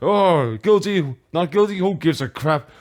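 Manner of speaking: ordinary talk, nothing marked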